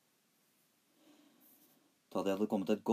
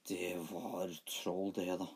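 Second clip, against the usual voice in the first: slow low troll voice; 3 semitones lower, 3 dB quieter, slower than usual. A narrator's reading. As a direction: growl it slowly like a troll. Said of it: "sa haugkjerringa".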